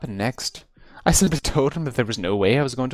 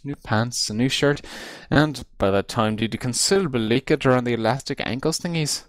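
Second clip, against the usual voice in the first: ordinary delivery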